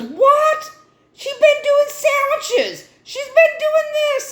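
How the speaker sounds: sky-high, astonished coworker voice; shocked disbelief. Retold or imitated. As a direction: gasp it out as if you can't believe it.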